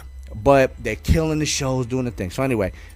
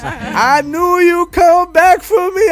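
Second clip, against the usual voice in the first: high, slow, louder falsetto tease; drag host; 8 dB louder, slower than usual. Retold or imitated. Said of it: "And she says".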